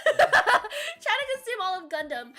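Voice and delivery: slow, laughing read; amused, laughing read. Real, not acted. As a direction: drawl it out while laughing. Laughs reading chat.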